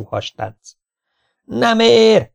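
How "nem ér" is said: high-pitched indignant yell; yelled protest. A narrator's reading. Swimmer objects that the race is unfair, yelling. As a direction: cry foul at the top. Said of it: "ordította Konrád Feri".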